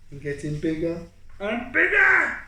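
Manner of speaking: rising thrill as the shark nears; building excitement. Acted. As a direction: grow it bigger with each word.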